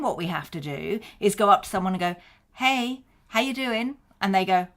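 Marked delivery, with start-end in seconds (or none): 2.5-4.0 casual pub chat-up. Retold or imitated. Acting out chatting someone up.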